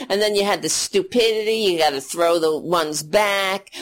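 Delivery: usual speaking voice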